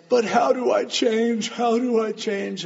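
high, anguished plea; imitated pleading. Husband begging the pastor for help, imitated pleading.

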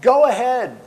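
loud, high-pitched dare; bold challenge. Acted. Elijah to the prophets of Baal, daring them. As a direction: throw down the challenge loudly.

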